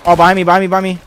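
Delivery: high, loud alarmed callout; loud combat callout. Real, not acted. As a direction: shout the warning fast. Enemy attacking from behind.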